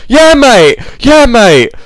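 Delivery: loud, high, over-eager lad; high-pitched mock yell; mock shouted reply. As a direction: shout it out like a hammed-up reality star. Acting out show cast's loud reply.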